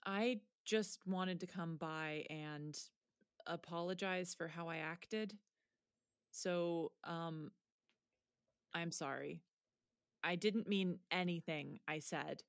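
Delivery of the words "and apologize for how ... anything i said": soft, low sheepish apology; quiet apology. Acted. Girl quietly apologizing to her teacher. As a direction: say sorry softly, embarrassed.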